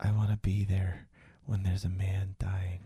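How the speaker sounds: soft whispered creepy voice. Retold or imitated. Host voicing the boy's new wish, whispering. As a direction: whisper it like a dead-eyed child. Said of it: "And he goes".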